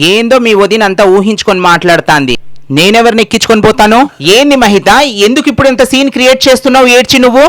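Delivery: high, loud exasperated yell; angry shouting. Acted. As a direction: shout her down, indignant. Husband shouts defending himself.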